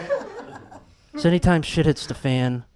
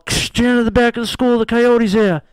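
loud, high radio alert; urgent summons over a crackly walkie-talkie; walkie-talkie call; 6 semitones higher, 5 dB louder than usual. Acted. Principal alerts janitor to the coyote, walkie-talkie voice. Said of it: "I get the call".

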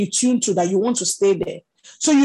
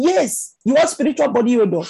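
tactless blurting; 3 semitones higher, 3 dB louder than usual. Acted. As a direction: blurt it out bluntly, pitched up. Mimics the tactless reply to avoid.

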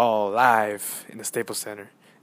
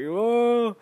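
a loud, higher, amazed "Whoa!"; crowd cheer; 13 semitones higher, 6 dB louder than usual. Retold or imitated. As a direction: cheer like the fans went wild. Fans hyped after basket.

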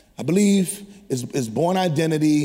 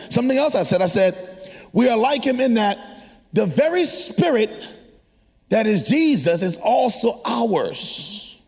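preaching; 7 semitones higher than usual. Acted. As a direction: preach it with rising fire. Long preached passage.

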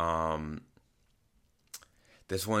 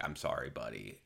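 soft sympathy; 7 semitones lower, 11 dB quieter than usual. Retold or imitated. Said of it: "And I was like".

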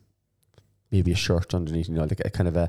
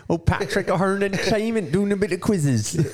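much higher, slow put-on voice; mock announcer voice; 13 semitones higher than usual. Host jokingly introduces his friend, high slow mock announcer voice. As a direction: introduce him grandly as a joke.

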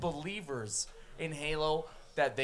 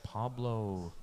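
soft, breathy ASMR murmur of the name; slow near-silent whisper, savoring each syllable; 7 semitones lower, 8 dB quieter, much slower than usual. Pretend. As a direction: hush it like an ASMR video. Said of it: "Can I just A S M R this".

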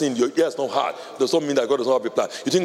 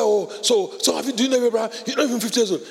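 mocking crowd; 8 semitones higher, faster than usual. Mocking crowd voiced in story.

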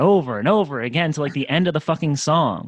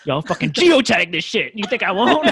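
loud, high, laughing taunt; mock challenge; 8 semitones higher, 7 dB louder than usual. Daring Tim Savo to come fight, mock challenge. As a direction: shout a hammed-up dare through laughter.

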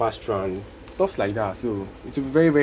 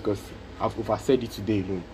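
ordinary talk, nothing marked

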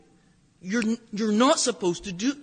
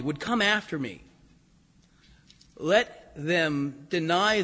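solemn slow reading; 3 semitones lower, slower than usual. Acted. Reading Jesus' words solemnly.

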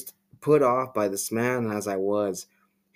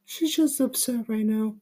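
much higher, smooth, buttery soft voice; soft creepy Tommy; 13 semitones higher than usual. Acted. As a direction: soothe them like soft butter, unsettlingly sweet. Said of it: "he said softly, his voice like soft butter".

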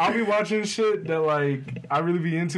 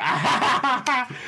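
imitated laughing fit; 8 semitones higher than usual. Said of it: "I'm sitting there like".

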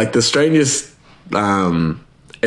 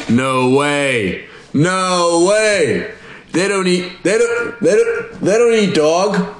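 high, drawn-out incredulous protest; mock disbelief; 9 semitones higher, slower than usual. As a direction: act out a stunned denial, pitch climbing high. Acted disbelieving listener in wartime rumour.

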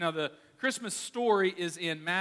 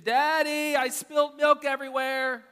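put-on high, loud kid calling from far off; child calling; 8 semitones higher, 6 dB louder than usual. Child calling dad from the kitchen, high and loud. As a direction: call out like a little kid in trouble. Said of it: "you hear from the kitchen".

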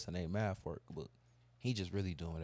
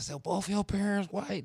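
high, slow disbelieving question; incredulous kid; 11 semitones higher, 5 dB louder than usual. Retold or imitated. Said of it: "She said".

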